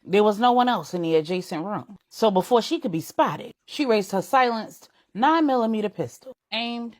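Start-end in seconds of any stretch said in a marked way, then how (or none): none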